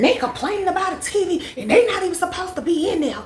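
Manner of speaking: high, loud mimicked griping; loud complaining. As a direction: imitate her complaining for everyone to hear. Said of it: "y'all, she was talking loud".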